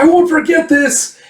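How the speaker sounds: very high, loud threat; vengeful yell. Retold or imitated. Man yells after the one stampeding sheep, vengeful. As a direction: act out shouting a threat after someone.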